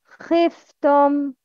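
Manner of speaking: خفتم said slowly with a clear kh and kasra; slow model word. Shown as a demonstration. Teacher modelling a kasra word for pupils, slow and clear. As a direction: draw out خفتم so pupils can copy it. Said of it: "Kasra examples".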